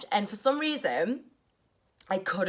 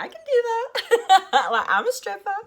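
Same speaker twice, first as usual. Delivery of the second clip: amused giggle; 13 semitones higher, 6 dB louder than usual. Retold or imitated. Agreeing to the client's request, laughing. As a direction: laugh it off, this is easy.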